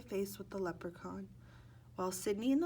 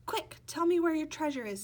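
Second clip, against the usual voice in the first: urgent plea; 8 semitones higher, 8 dB louder than usual. Acted. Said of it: "Sidney said".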